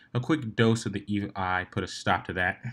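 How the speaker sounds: ordinary talk, nothing marked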